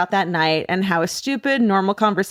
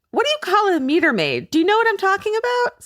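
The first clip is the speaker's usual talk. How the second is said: tipsy pushy voice; 12 semitones higher than usual. Retold or imitated. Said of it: "And said".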